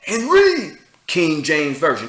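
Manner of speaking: slow, loud hammed-up preacher chant; mock street preacher. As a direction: repeat it like a bullhorn sermon. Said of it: "Keep saying it".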